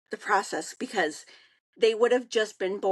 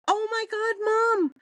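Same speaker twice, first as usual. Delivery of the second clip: excited exclamation; 13 semitones higher than usual. Retold or imitated. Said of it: "she goes".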